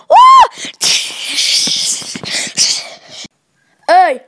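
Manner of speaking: very high, loud whoops; excited cheering. Pretend. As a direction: cheer like you just won. Pretend cheering.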